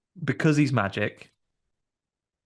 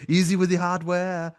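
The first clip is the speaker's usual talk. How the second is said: Arnie impression; 7 semitones higher than usual. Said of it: "and he goes".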